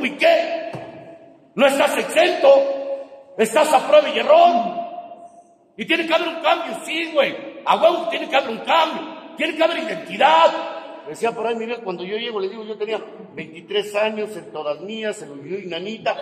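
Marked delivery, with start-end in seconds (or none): 0.0-10.5 raised voice lecturing the group, building to a shout; fiery preaching. Real, not acted. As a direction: drive each point home like a preacher. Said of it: "preaching rant at members".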